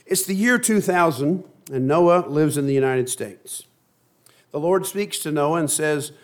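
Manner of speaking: reads the tale to the congregation; storyteller voice, a little brighter; lively read-aloud to the church; story reading. A narrator's reading. Preacher reads Noah story aloud.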